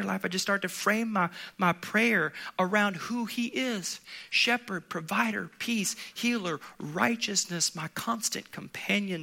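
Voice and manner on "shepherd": normal talking voice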